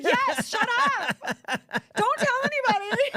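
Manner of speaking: laughing denial. Retold or imitated. Caught by Ian, laughing.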